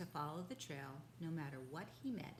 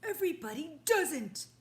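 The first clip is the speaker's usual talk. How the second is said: much louder, higher angry rhino; 9 semitones higher, 13 dB louder than usual. Acted. Rhinoceros scolding the father, angry. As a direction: snap back at the intruder. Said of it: "said the angry voice".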